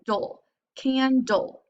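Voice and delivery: dull and candle drawn out slowly; slow blending. Shown as a demonstration. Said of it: "Now I'm combining the consonant L E".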